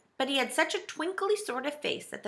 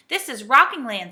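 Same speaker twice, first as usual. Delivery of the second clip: louder, dismayed exclamation; 3 semitones higher, 8 dB louder than usual. A narrator's reading. Moonface recognises the tipping land, in dismay. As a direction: announce it with a touch of dread. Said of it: "said Moonface in dismay".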